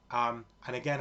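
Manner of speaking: ordinary talk, nothing marked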